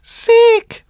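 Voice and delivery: very high, loud little cat echo. Acted. Cat repeats the fox's word, high echoing voice. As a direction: pipe up with a high-pitched echo. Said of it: "repeated the cat".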